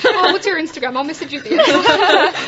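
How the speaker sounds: 1 laughing mock brush-off. Mock evasive reply, laughing.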